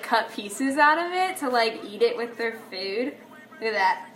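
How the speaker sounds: ordinary talk, nothing marked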